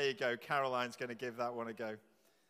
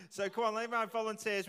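laughing call for volunteers; 6 semitones higher, 7 dB louder than usual. Real, not acted.

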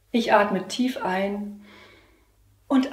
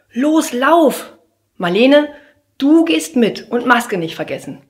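loud, higher hurried orders; urgent teacher command; 7 semitones higher, 9 dB louder, slower than usual. Acted. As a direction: hurry them out the door. Teacher urges kids away. (laute Lehrerin, drängend)

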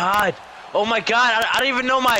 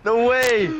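excited shouting; 13 semitones higher, slower than usual. Real, not acted. Reacting to wild play.

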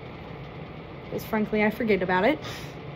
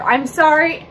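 mock apology; 5 semitones higher, 7 dB louder than usual. Mock apology, lipstick rant.

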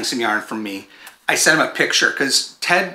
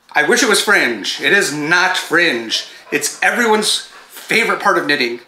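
higher-pitched, playful complaint; mock lament; 4 semitones higher than usual. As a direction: lay on the sarcastic dismay. Mock lament over loose yarn ends.

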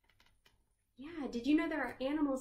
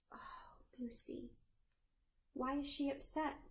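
whisper; 2 semitones higher, 8 dB quieter than usual. Real, not acted. Storyteller pities crying firefly.

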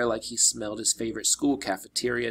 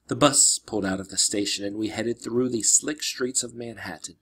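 ordinary delivery, nothing marked

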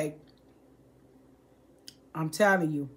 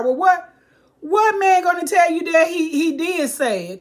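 indignant disbelief; 8 semitones higher, 4 dB louder than usual. Real, not acted. Indignant rhetorical question.

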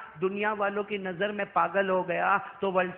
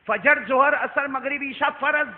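each prayer name called out loud and higher; loud, high preaching list; 6 semitones higher, 8 dB louder than usual. Shown as a demonstration. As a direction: hammer out every name to the crowd. Same list repeated, now shouted. (ہر نماز کا نام بلند اور اونچی آواز میں پکارنا)

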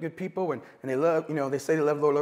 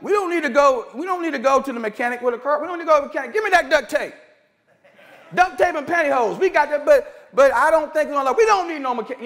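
higher, louder self-assured fixer; mock know-it-all; 7 semitones higher, 4 dB louder, faster than usual. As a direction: play the cheapskate who refuses the mechanic. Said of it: "or you're like".

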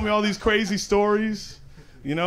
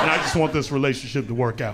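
usual speaking voice

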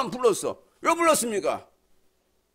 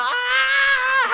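imitated shout; 3 dB louder than usual. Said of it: "대여섯 사람만".

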